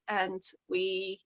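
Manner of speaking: ordinary talk, nothing marked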